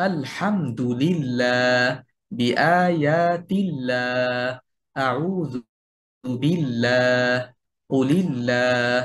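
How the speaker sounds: slow recited examples, each "ল্লাহ" a light "llaa"; thin lam. Shown as a demonstration. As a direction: draw out "ফিল্লাহ" and the rest with a thin final lam. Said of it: "এভাবে করে আমরা পাতলা করে". ("ল্লাহ"-এর লাম পাতলা করে, টেনে)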